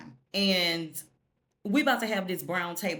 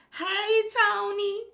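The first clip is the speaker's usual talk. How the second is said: sing-song greeting; 5 dB louder, slower than usual. Real, not acted. Greeting Tony Danza.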